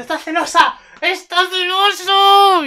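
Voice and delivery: high, loud fan squeal; thrilled squeal. Real, not acted. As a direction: squeal it out, stretching the last cry. Squealing over the jealousy scene.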